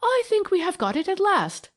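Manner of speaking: high, triumphant and eager. A narrator's reading. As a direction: announce the find with triumph. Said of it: "He looked triumphantly at Venner".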